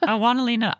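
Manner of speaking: high-pitched mock pleading; whiny demand. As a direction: playfully whine for what you want. Repeats mock tantrum.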